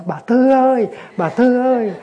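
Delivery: the neighbour yelling over her prayers; shouted call. Retold or imitated. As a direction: holler her name to drown her out. Neighbour calling over the chanting.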